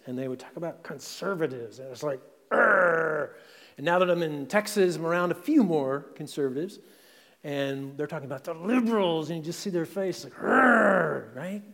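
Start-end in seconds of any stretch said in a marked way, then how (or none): 2.5-3.3 disgusted groan. Retold or imitated. Mimicking progressives' disgust at conservatives. 10.3-11.4 loud, mock groan of disgust. Pastor mimics conservatives sneering at liberals, loud disgusted urgh.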